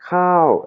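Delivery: "Cow" pitched very high, then falling; short, high tone. Shown as a demonstration. Teacher contrasts it with knee, high tone on "Cow". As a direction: hit "Cow" high and loud, let it drop. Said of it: "et intonation haute".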